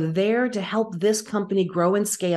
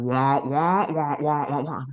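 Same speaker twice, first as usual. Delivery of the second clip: lower, droning wah wah like a muted trombone; muffled wah wah; 4 semitones lower than usual. Retold or imitated. Recalling the CEO's talk to the host as a low mocking wah wah. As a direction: make the boss's talk sound like meaningless noise. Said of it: "And I hear".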